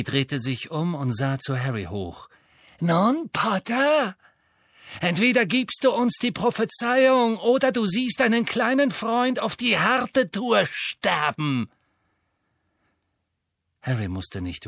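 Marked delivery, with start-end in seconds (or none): 2.8-11.7 higher mocking menace; Bellatrix threatening. A narrator's reading. Bellatrix threatening Harry, mocking menace. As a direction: threaten him sweetly.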